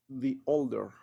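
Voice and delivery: put-on "the older" with "the" said as "thee" before the vowel; "the" sounds like "di" before "older"; English pronunciation demo. Shown as a demonstration. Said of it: "aquí este se pronuncia como the".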